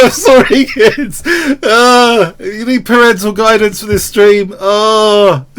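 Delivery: words squeezed out between laughs; laughing through words. Real, not acted. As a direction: try to talk while cracking up. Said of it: "I'm laughing".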